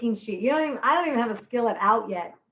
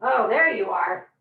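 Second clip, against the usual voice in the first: ordinary delivery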